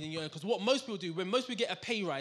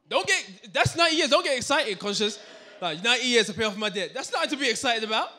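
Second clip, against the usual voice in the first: high, loud amazed protest; mock their excitement loudly; incredulous exclamation; 6 semitones higher, 12 dB louder than usual. Real, not acted. Mocking excited audience.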